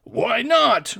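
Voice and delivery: loud, high roared retort; enraged roar. A narrator's reading. Giant brandishing a tree at his companion, roaring. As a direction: roar it back in a rage. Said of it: "roared his companion in a great rage".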